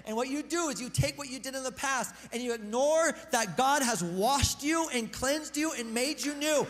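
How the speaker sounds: normal talking voice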